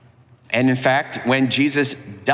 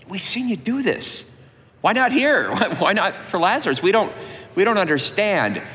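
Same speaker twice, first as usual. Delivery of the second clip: pleading sisters; 6 semitones higher than usual. Acted. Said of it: "In other words".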